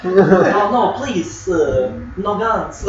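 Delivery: loose mock French accent, pleading; bad French accent. Player joking along as surrendering French elves, bad French accent. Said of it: "So far from French accents".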